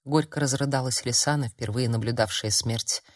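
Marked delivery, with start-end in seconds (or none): none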